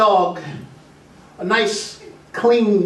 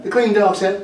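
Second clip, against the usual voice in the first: ordinary delivery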